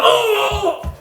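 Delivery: high, hammed-up pained groan; high laughing mock groan of pain. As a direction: mimic him crumpling, laughing. Imitating the hit man's groan.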